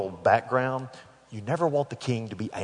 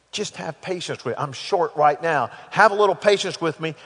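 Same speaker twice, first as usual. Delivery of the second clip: pleading; 5 semitones higher, 5 dB louder than usual. Acted. Servant begs, acted out.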